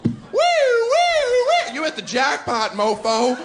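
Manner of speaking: high, loud siren whoops then jackpot yell; mock slot-machine siren into a loud jackpot holler; car alarm whoop. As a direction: wail like a siren, then crow.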